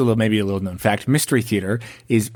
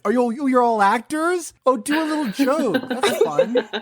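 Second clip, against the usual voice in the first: high, teasing mock host; teasing the guests as a pushy host; 13 semitones higher than usual. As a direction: needle the guests like a pushy interviewer.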